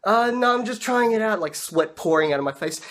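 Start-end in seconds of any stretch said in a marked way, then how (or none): 0.0-1.4 high, loud, hurried-sounding cover-up; flustered bluffing. Acted. Rider bluffing the shopkeeper, flustered and high. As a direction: brush it off too brightly, pitched up.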